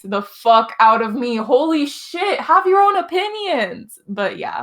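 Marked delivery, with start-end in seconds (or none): 1.4-4.0 exasperated, high and drawn-out; fed up, stretching the words out high; exasperated venting. Real, not acted. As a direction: vent in slow, high-pitched exasperation. Venting about Orange's irritating game.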